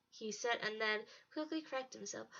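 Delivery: plain reading voice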